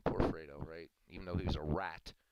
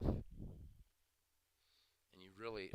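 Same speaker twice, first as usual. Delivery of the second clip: ordinary talk, nothing marked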